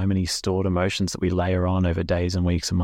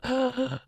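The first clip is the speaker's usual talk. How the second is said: high sudden gasp of shock; sharp gasp; 4 dB quieter than usual. Retold or imitated. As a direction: gasp like hitting ice water. Ice bath gasp.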